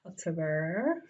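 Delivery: ordinary talk, nothing marked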